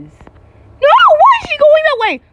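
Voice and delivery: high, loud wail of alarm; shrieked, alarmed question; panicked cry. Real, not acted. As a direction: yell it out in panic. Panicking as mouse turns towards player.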